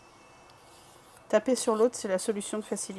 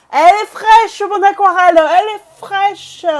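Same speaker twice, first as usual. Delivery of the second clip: loud, high-pitched hawking; belted playful sales cry; market seller's cry; 10 semitones higher, 18 dB louder than usual. Pretend. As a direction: call out your wares across the market.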